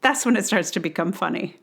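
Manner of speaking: ordinary talk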